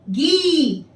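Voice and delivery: गी with a clearly stretched long ii; drawn-out long ii. Shown as a demonstration. Teacher showing pupils गी with a long ii. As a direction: stretch the vowel of गी. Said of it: "देखिए ग का उच्चारण किस प्रकार होता है".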